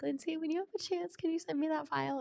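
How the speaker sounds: high-pitched, sweetly polite request; put-on sweet, high asking voice; kindly nagging. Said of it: "I mean kindly being like".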